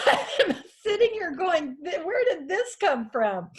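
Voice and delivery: real tears caught in a laugh; laughing through tears. Real, not acted. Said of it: "I've stopped apologizing for this 'cause it just comes and goes".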